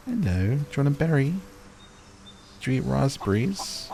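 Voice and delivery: high sweet voice for an animal; pet talk. Real, not acted. Player coaxing a chicken with berries, high pet talk. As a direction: coax the chicken gently.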